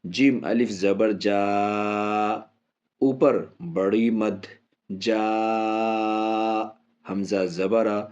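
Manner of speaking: slow, drawn-out letter-by-letter spelling; teacher's slow Quran spelling drill. Shown as a demonstration. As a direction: spell it out slowly for the pupils. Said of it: "اسی طریقے سے ہجے کریں جو میں بتا رہا ہوں".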